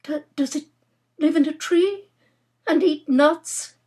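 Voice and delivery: high, faltering question; anxious hope. Acted. Nora hoping it is only a squirrel, anxious hope. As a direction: stammer into a desperately hopeful, high question. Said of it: "hoping that the use of the adjective large".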